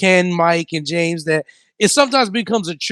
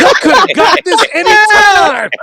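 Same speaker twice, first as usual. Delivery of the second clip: indignant shout; 14 semitones higher, 6 dB louder than usual. Retold or imitated. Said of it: "he goes".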